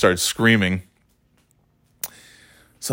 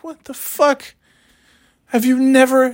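high-pitched incredulous outburst; baffled, indignant squeal at a rude driver. Retold or imitated. Fuming at the old lady pushing in front, high-pitched incredulous. Incredulous reaction to being cut off.

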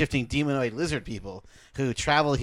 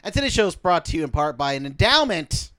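ad read; 6 semitones higher, 3 dB louder than usual. Acted. Mock sponsor read.

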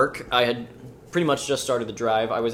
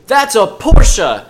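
slow, high, loud insistence; mock a pushy stranger; insistent shout; 9 semitones higher, 13 dB louder, much slower than usual. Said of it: "And she goes".

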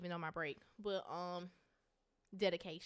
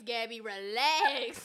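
slow laughing plea; 3 semitones higher, 8 dB louder, much slower than usual. Real, not acted. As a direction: stretch it out while laughing.

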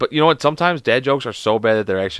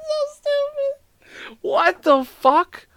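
very high, slow, laughing wheeze; helpless laughter; much slower than usual. Real, not acted. Still undone by the stupid joke, laughing helplessly. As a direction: squeeze the words out through tearful laughter.

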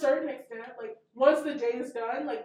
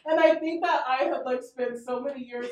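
ordinary delivery

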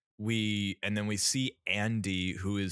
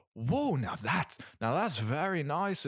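Benoit Blanc; 6 semitones higher than usual. Acted. Podcaster imitating an awestruck detective in a trippy scene, shaky accent attempt. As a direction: put on an amateurish imitation of his accent. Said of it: "I can't do his accent".

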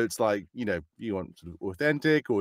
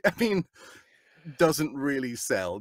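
usual speaking voice

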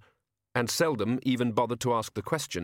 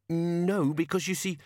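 high, hurried protest; nervous toymaker objecting; flustered shopkeeper; 8 semitones higher than usual. Acted. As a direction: shopkeeper trying to refuse.

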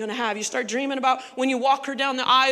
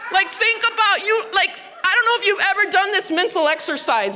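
excited; 6 semitones higher than usual. Real, not acted. Preacher breaks out excitedly.

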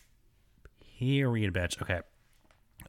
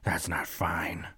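hushed, slow scolding whisper; stern dentist whisper; 3 semitones lower, 7 dB quieter, slower than usual. Retold or imitated. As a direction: whisper it slowly like a disapproving dentist. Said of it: "And she's like".

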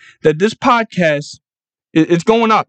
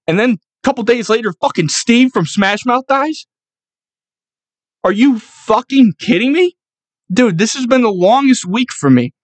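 infuriated; 6 semitones higher than usual. Real, not acted. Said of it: "but I'm fucking infuriated".

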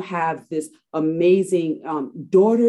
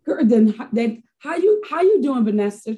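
higher and louder, a delighted hello on the phone; light up as a friend calls, slow and sing-song on "how you doing"; mother picking up a friend's call, greeting her with loud high delight; cheery phone greeting; 8 semitones higher, 7 dB louder, slower than usual. Retold or imitated.

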